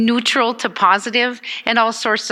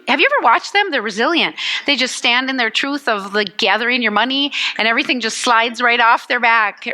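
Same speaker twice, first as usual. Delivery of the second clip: normal talking voice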